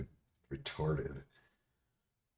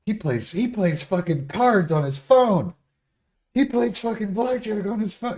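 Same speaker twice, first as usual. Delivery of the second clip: much higher, louder, voice straining with disbelief; incredulous outburst; 9 dB louder than usual. Real, not acted. Streamer mocks a critic who plays blackjack, high incredulous squeal. Mocking hypocrisy, voice cracking high.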